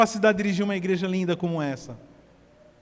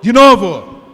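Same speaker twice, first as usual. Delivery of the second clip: much louder shouted command; loud urging; 6 semitones higher, 14 dB louder, much slower than usual. Real, not acted. Pastor urges the congregation to call again, shouting. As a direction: push the crowd to go again.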